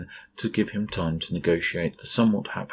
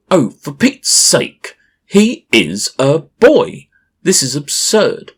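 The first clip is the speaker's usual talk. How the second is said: shouting; 10 semitones higher, 13 dB louder than usual. A narrator's reading. Uncle furious at the game, loud high angry shout. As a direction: bellow your outrage at the absurdity. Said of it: "he shouts".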